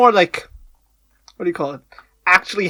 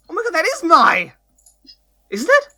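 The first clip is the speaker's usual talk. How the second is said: excited surprise; 10 semitones higher than usual. Real, not acted. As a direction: pitch jumps up in realization. Recognizing the actress excitedly.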